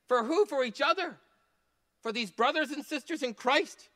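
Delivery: high, urgent preaching voice; impassioned preaching. Real, not acted. Preacher charging the congregation to serve each other, impassioned preaching. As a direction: lift it high and drive the charge home.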